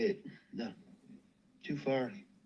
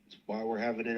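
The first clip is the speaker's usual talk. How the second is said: ordinary delivery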